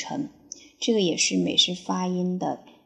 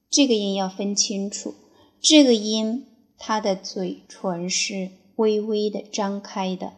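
ordinary delivery

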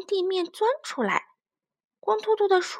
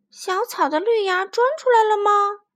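high, louder curious call; eager child voice; 8 semitones higher, 6 dB louder than usual. Acted. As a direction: search hopefully. Child searching for sprouts.

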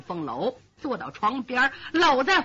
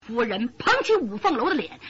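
ordinary delivery

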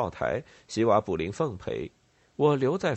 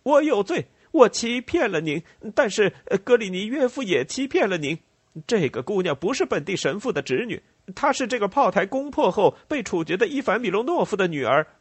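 high, loud, frantic confession; enraged outcry held far above normal pitch; furious shouting; 9 semitones higher, 5 dB louder than usual. A narrator's reading. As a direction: denounce them in a raised, enraged voice. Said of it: "他狂怒的大声说".

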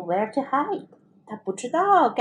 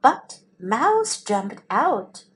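ordinary delivery, nothing marked

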